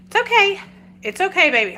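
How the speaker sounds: high, loud soothing coo; calm the sick dog gently; soothing a scared dog. Real, not acted.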